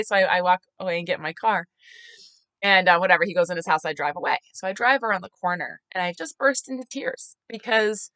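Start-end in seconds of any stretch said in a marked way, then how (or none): none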